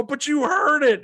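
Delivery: high, loud, stretched-out comeback; mock insistent shout. Man arguing with his friend, insistent mock shout. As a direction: shout it back high and slow.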